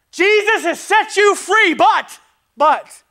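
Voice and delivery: high, loud, slow cry of joy; proclaiming. Acted. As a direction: ring it out to the back row. Proclaiming freedom in Christ.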